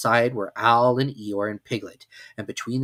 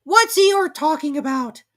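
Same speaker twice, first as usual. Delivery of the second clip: whispering Piglet; 8 dB louder, slower than usual. A narrator's reading. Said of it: "Piglet whispered to Pooh".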